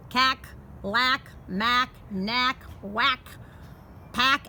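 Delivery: usual speaking voice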